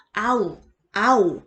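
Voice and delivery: 아우, 아오 as two separate gliding vowel sounds; variant pronunciations. Shown as a demonstration. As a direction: demonstrate 아우, then 아오, each distinctly. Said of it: "요런 느낌으로 다양하게 발음이".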